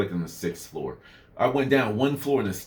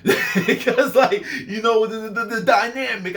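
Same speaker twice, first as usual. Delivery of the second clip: laughing; 9 semitones higher, 4 dB louder, slower than usual. Real, not acted. Laughing through words.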